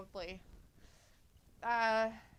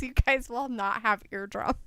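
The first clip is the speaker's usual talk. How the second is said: usual speaking voice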